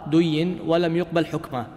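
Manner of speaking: ordinary delivery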